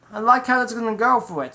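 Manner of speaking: ordinary delivery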